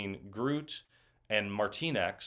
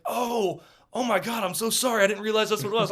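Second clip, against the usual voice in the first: imitating Stallone gushing sorry in a much higher voice; mock-sweet flustered apology, pitched way up and louder; 12 semitones higher, 6 dB louder than usual. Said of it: "And he was like".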